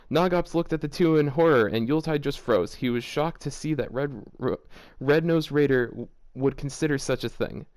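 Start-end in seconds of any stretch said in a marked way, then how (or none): none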